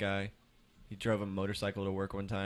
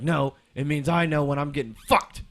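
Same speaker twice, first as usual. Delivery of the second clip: imitating a yelled comeback, higher and louder; angry customer; 6 semitones higher, 10 dB louder than usual. Customer yelling at the manager about the oil price, mock shout. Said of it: "And he's like".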